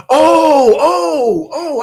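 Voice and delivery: high, loud cry of recognition; sudden realization. Real, not acted. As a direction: it just clicked, out loud. Suddenly remembering who Trevor is.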